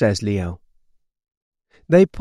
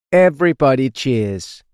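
ordinary delivery, nothing marked

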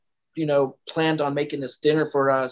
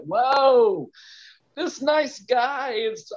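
much higher, slow disbelieving whoa; shocked; 9 semitones higher, much slower than usual. Retold or imitated. Girl stunned as friendly host turns angry, high disbelief. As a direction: retell your stunned disbelief. Shocked at his sudden anger.

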